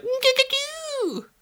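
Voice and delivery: very high, drawn-out squeal of gigagoo. Retold or imitated. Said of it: "He's like".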